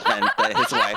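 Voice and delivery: laughing through the character line. Acted. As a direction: laugh the words out mid-line. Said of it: "Penyo goes".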